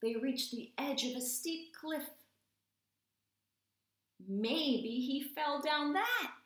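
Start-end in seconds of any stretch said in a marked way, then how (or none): 4.2-6.4 high, louder, slow wail; wailing cat. A narrator's reading. Cat wailing about the cliff edge. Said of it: "wailed the cat".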